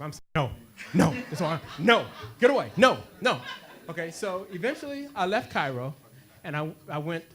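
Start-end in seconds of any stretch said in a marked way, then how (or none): 0.3-3.4 loud, abrupt mock-aggressive refusals. Tourist waving off Cairo vendors, mock aggressive. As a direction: fend them off loudly, playing it up. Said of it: "I'm just as aggressive".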